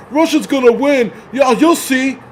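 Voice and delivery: high, sneering mock voice of a smug pro-Russia fan; mocking pro-Russia viewers. Mocking smug pro-Russia viewers to his audience, high sneering voice. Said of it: "you guys are like".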